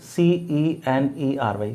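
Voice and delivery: each letter of एस सी ई एन ई आर वाई said slowly and separately; slow spelling out. Shown as a demonstration. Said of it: "इसमें ध्यान रखना".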